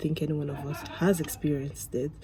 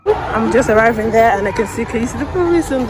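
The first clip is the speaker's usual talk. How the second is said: much louder and higher, calling over noise; raised voice; 6 semitones higher, 12 dB louder than usual. Real, not acted. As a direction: shout to the camera over the crowd. Speaking over a noisy protest on arrival.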